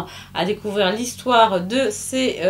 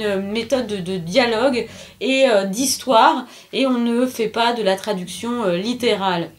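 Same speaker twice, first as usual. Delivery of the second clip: ordinary delivery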